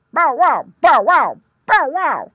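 loud, high barking bow wow; loud barking dog. A narrator's reading. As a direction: bark like a big farm dog. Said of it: "went some animal".

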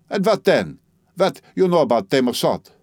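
a proud old Frenchwoman mocking him in her broken English; French-accented old lady. Old Frenchwoman mocking the narrator, French accent.

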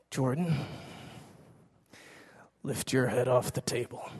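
very low, controlled warning with long pause; frustrated dad. Retold or imitated. Father to son slumped on table, low frustrated warning. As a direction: drop the pitch right down, holding in frustration. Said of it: "Now I'm a little frustrated".

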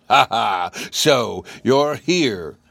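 drawn-out, gloating cry; king's cry. A narrator's reading. Angry king greets his arriving general, crying out slowly. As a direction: cry out slowly, with a mock laugh first. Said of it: "cried the king".

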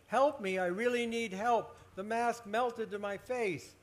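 high, loud, panicked plea; desperate cry for help. A narrator's reading. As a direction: beg for help in alarm. Narrator acts screamed plea for help.

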